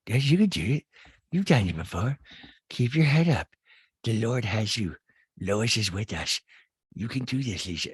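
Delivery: lightly mocked self pep talk; put-on earnest encouragement in short phrases; Rinna. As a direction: coach yourself with mock solemnity. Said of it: "but you're like".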